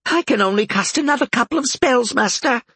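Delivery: high squeaky little imp. A narrator's reading. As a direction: squeak it out, nervous and small. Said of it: "Bob said".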